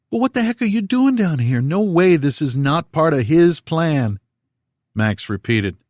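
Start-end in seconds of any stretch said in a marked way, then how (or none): 0.1-4.2 agitated disbelief. A narrator's reading. Dazed Max protests loudly.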